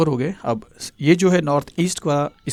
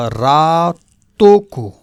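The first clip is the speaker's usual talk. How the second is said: "रातों" drawn out slowly and loud; stressed word; 2 semitones higher, 8 dB louder, much slower than usual. Shown as a demonstration. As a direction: lean hard on "रातों", stretched long. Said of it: "teacher stresses mnemonic word". ("रातों" पर ज़ोर, लंबा खींचकर)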